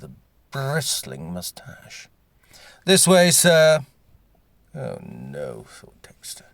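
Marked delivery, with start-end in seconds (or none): 2.8-3.8 loud, slow barked order; barking guardsman. Acted. Guardsman barks orders at arriving guest Dexter, loud and slow. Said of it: "a barking uniformed guardsman". 4.7-5.6 whispered dismay. Acted. Dexter's dismayed private thought.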